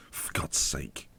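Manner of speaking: whispered under the breath, fed up; exasperated whisper. Real, not acted. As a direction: let it out in a defeated whisper. Keeper blunder, muttered exasperation.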